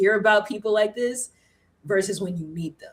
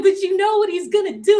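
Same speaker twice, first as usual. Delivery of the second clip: much higher, laughing objection; high laughing protest; 14 semitones higher, 6 dB louder than usual. Retold or imitated. Protesting to the dancer friend about the buyer, high laughing. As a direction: squeal it through a laugh.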